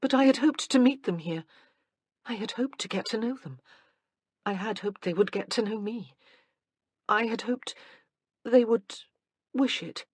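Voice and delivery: a touch of wistful disappointment; faltering as each hope sinks; hurt hope. Acted. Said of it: "She felt very foolish".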